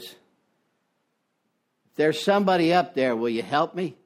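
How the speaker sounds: put-on a drunk hanging from a cliff begging the sky for help; desperate drunk. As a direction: call up to the heavens, slowly and desperately. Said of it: "and he says".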